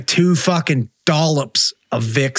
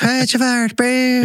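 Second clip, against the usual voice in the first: higher-pitched, badly faked Irish ghost; hammed-up, garbled mock-Irish; bullshit Irish accent; 7 semitones higher, slower than usual. Ghost calling at castle visitors, bad Irish accent. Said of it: "fucking bullshit Irish accents".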